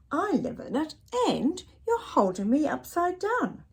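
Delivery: little bird voice. Acted.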